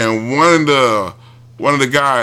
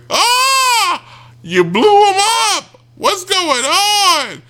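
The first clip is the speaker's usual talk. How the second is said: shrill, much higher fake panic; mock panicked scream; much slower than usual. Victims inside the cabin freak out over the blast, hammed-up panicked squeal.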